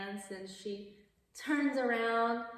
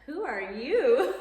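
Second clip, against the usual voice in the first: loud demand; 5 semitones higher, 12 dB louder, slower than usual. Retold or imitated. Family demands who the stranger is.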